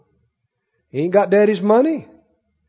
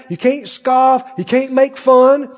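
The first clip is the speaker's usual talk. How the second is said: ordinary talk, nothing marked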